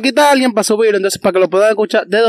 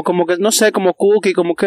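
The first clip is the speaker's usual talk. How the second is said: ordinary talk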